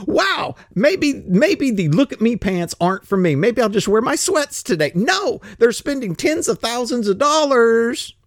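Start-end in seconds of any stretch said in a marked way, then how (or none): 0.1-4.9 much higher, musing as a sensible woman; high mock voice of a woman picking sweats; mock woman's voice. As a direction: lift the voice high and play a woman thinking it over. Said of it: "instead of just saying".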